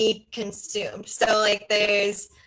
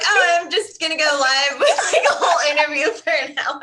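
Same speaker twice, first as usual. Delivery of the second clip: loud, high, laughing through it; laughing self-quote; 6 semitones higher, 6 dB louder than usual. Retold or imitated. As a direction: quote yourself while cracking up. Quoting herself now, laughing.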